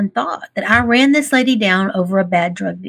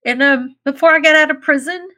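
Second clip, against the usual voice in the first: real tearful voice, pitched higher, catching; happy tears; 5 semitones higher than usual. Real, not acted. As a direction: speak through real tears of pride. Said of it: "It's happy tears".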